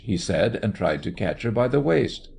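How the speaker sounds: ordinary delivery, nothing marked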